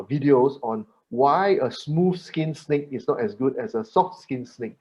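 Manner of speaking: ordinary delivery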